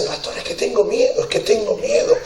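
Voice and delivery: high, timid frightened plea; scared plea. Retold or imitated. Pastor retelling a woman afraid of baptism, high timid plea. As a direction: mimic her fear of the water, high and small. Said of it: "Y me decía".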